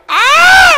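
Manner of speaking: very high, loud held cry; imitated piercing whoop; grandfather's yell. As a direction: holler like grandpa in the cantina. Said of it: "mi abuelo gritaba y decía".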